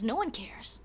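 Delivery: ordinary talk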